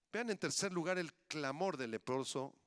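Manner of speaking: usual speaking voice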